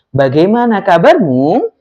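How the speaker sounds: "Bagaimana kabarmu" lifted at the end; questioning rise. Shown as a demonstration. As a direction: let "kabarmu" climb like a question. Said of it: "ujungnya itu ada nada naiknya". (nada naik di ujung "kabarmu")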